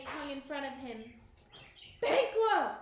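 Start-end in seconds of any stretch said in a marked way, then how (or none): 2.0-2.8 high, loud roar of terror. A narrator's reading. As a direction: roar his name in horror. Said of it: "Macbeth roared".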